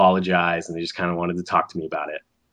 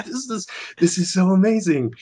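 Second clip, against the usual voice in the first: excited; 11 semitones higher than usual. Retold or imitated. Retold reaction to offer.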